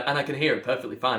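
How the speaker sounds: ordinary talk, nothing marked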